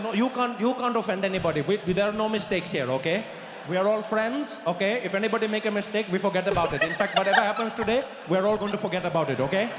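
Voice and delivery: put-on Indian English, insistent; Indian accent. Pushing Steve to order, insistent Indian accent. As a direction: urge politely in an Indian accent. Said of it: "You got a bit of an accent".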